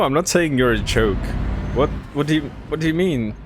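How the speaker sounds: ordinary talk, nothing marked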